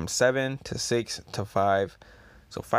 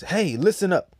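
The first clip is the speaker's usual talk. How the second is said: calling for attention; 8 semitones higher, 5 dB louder than usual. Acted. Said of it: "he says".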